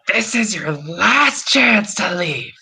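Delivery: menacing hag warning. Acted. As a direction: give the final warning as a hag. DM voicing the hag.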